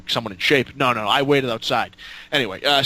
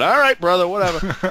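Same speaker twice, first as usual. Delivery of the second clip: high, louder humouring reply; mock-agreeable, raised pitch; retold dismissive acceptance; 6 semitones higher, 3 dB louder than usual. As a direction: give in with a pitched-up brush-off. Retold reply humouring drinking wrestler.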